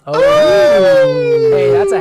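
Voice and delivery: sudden high, loud Oh!; excited shout. Real, not acted. Co-host reacts to a word, loud high shout. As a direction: burst out with delight.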